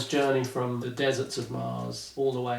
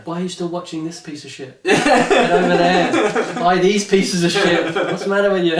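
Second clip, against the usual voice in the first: mock angry pitch; 4 semitones higher, 7 dB louder than usual. Mock angry sales pitch to viewers.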